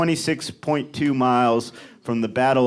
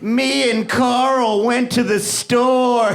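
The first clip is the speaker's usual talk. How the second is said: slow mock quote; 8 semitones higher, much slower than usual. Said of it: "that person is like".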